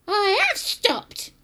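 high, loud, slow granny voice; loud, drawn-out grandma reply; boastful grandma. Acted. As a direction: crow it as a triumphant old woman. Acting Grandma.

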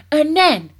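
loud, high exclamation; overexcited, ringing call; excited cry. A narrator's reading. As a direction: burst in with the next step. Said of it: "he cried".

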